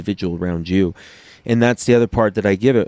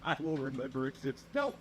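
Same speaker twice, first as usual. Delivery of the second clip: laughing mock repeat; 3 semitones higher, 18 dB quieter, faster than usual. Real, not acted. Repeating his friend's phrase, choking with laughter. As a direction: savor the phrase while cracking up. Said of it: "I am gonna piss myself".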